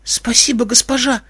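high, meek thanks; small, pitiful high voice; plaintive babble. A narrator's reading. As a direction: thank her piteously, like a frightened waif. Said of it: "жалобно залепетала та".

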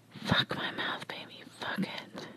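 very soft, voiceless whisper; urging whisper. Acted. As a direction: urge them on under the breath. Urging acted in story.

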